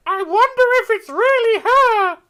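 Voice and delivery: mock character voice. Voicing a character's thought.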